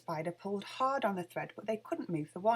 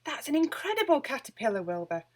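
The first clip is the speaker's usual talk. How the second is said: higher, louder, amazed witch; Winnie's delighted exclamation; 5 semitones higher, 4 dB louder than usual. A narrator's reading. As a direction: gasp it out in wonder. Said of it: "Winnie said".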